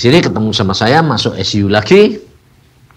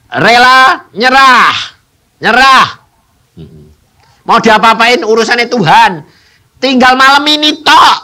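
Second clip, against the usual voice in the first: loud high preaching; preaching at full force; 11 semitones higher, 7 dB louder than usual. Acted. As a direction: drive the story home to the crowd. (berkhotbah dengan lantang)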